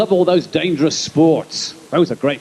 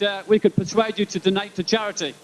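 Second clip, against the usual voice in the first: ordinary talk, nothing marked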